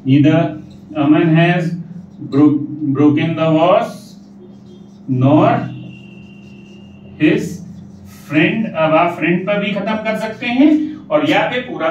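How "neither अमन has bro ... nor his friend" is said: each word set apart slowly for copying; teacher dictating the joined sentence, word by word; slow dictation. Shown as a demonstration. Teacher reads the sentence aloud to students, slow and spaced. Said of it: "तो neither में change कर लिया".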